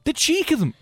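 outraged squeak of disbelief; indignant. Real, not acted. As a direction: pitch it high with mock outrage. Indignant rant about walkers.

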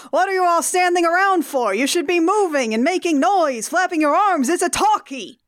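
high-pitched cartoon film director; Zoidberg's uncle. Cartoon uncle directing a movie, hectoring the cast. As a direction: berate the actors in a put-on high voice. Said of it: "Zoidberg's uncle directs a movie".

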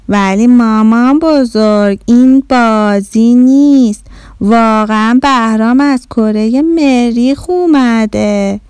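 put-on slow, stretched-out child's explanation; drawn-out, emphatic correction; earnest insistence. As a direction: spell it out slowly for grandma.

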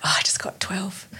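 mock whisper of relief; half-breathe it, unvoiced and hushed; a soft secretive whisper to herself; whispered aside. Quoted self, whispered.

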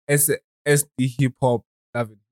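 ordinary delivery

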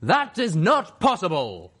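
loud, high, slow ghoul proclamation; booming announcement. A narrator's reading. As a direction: proclaim it with monstrous volume. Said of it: "Canterlot Ghoul Reaper pony announced".

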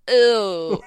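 loud, drawn-out group "ew"; mock disgust. Voicing three elves reacting to gross Santa, mock-disgusted.

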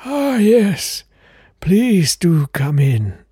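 put-on very high, airy old gentleman; fluting count voice. Old count answering the door, very high fluting voice. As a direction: pipe up like a fussy old aristocrat.

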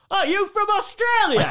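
high, loud, in-your-face voice; mock brash question. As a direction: ham up the pushy stranger talk. Imitating friend leaning into stranger's face.

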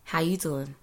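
attempted American accent. Shown as a demonstration. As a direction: put on a laid-back American greeting. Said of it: "my best American accent".

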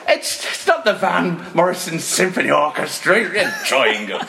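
high, drawn-out comic protest; imitating an exasperated bandleader; mock incredulous. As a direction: scoff at an absurd idea. Scoffs at triangle suggestion, acted.

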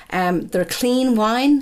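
mock American accent. Quoting the wine brand's slogan in a mock American accent. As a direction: stretch it like a US wine ad. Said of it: "Are you gonna do this whole episode in an American accent?".

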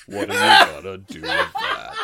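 laughing, high then loud gloomy donkey; Eeyore voice. Acted. Scoffing brother voiced as Eeyore, laughing donkey voice. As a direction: break up laughing mid-Eeyore. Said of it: "such a good Eeyore".

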